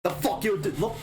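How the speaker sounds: high, fumbling Cockney attempt; Cockney accent. GM tries the Lagoon's Cockney line. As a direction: reach for a London thug voice. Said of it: "I'm trying to think of the accent".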